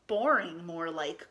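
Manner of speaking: a touch of sulky duck, falling away; grumpy duck. A narrator's reading. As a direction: dismiss it with understated grump. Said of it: "grumped Duck".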